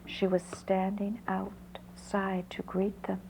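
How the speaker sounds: ordinary delivery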